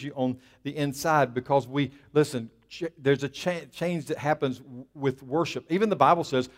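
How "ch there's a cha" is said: ordinary talk, nothing marked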